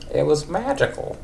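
put-on high wavering old lady voice. Old lady Marge answers Ned after their night. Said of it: "old lady for Marge".

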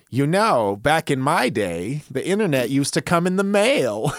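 high, loud wavering granny voice; old lady voice. Acted. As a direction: play a nostalgic old lady. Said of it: "old lady voice".

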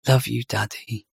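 put-on soft, drowsy little child saying goodnight; sleepy kid drifting off, murmuring.

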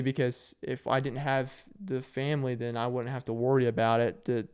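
ordinary delivery

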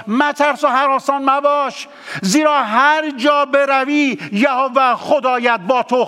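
verse proclaimed high and insistent, مترس driven hard; preached verse. Shown as a demonstration. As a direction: call the verse out to the congregation. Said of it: "با این آیه دوباره میخونمش".

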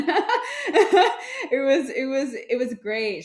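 high laugh spilling into the words; real laughter. Real, not acted. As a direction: break up laughing, then talk through it. Amused at recalled casting line.

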